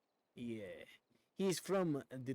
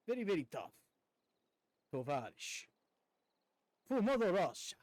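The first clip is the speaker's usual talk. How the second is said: a hammed-up Russian accent, higher on For Mother Russia; over-the-top Russian accent, louder at the end; Zangief Russian accent; 5 semitones higher, 3 dB louder than usual. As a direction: play it tough and blunt like a Russian wrestler, in a Russian accent. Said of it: "Zangief style".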